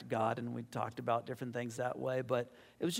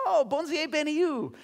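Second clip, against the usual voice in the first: much higher, louder bright bonjour; cheery greeting; 13 semitones higher, 6 dB louder than usual. Retold or imitated. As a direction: greet her delighted, voice jumping up. Greeting the Haitian woman in French.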